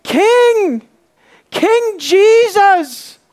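high, loud, drawn-out proclamation; full-voiced, soaring cry; shouting. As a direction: shout it out like a beggar hailing his king. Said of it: "as he's shouting".

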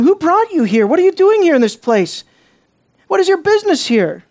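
surprised questioning. Acted. Danites question the Levite.

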